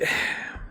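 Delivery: weary, voiceless sigh; exasperated sigh. Real, not acted. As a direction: let out a fed-up breath. Reviewer exasperated with rushed story.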